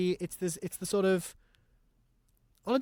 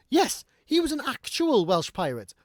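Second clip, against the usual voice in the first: excited mock quote; 9 semitones higher, 6 dB louder than usual. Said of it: "and going".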